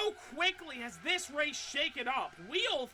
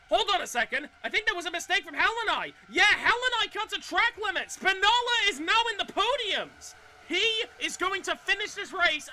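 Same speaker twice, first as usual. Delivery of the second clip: excited; 6 semitones higher, 6 dB louder than usual. Real, not acted. Race commentator reacts to incident.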